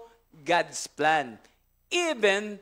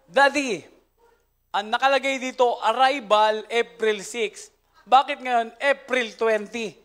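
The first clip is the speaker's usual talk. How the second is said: laughing quote; 4 semitones higher, 6 dB louder than usual. Retold or imitated. Child questions daddy, laughing.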